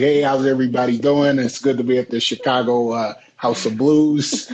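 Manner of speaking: generic Chicago accent, playing the club host; put-on Chicago emcee. Shown as a demonstration. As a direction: greet the crowd like a Chicago local. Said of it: "he should probably talk like that".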